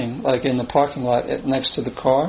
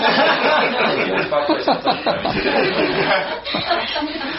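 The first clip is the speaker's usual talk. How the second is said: a long, high burst of laughter; helpless laughter that keeps going; 12 semitones higher than usual. Real, not acted. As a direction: laugh hard at the funny moment. Laughs after the karmic clothes line.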